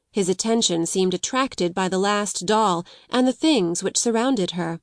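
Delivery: usual speaking voice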